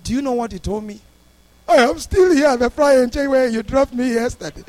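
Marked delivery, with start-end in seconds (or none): 1.7-4.6 loud, high retold lament; mock plaintive wail. Stranded man answering the pastor's call, imitated loud wail.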